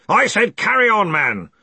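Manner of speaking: loud, high, angry command; exasperated boss losing patience; impatient order. A narrator's reading. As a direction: bark it at a dithering subordinate. Ridcully orders frightened Collarbone on.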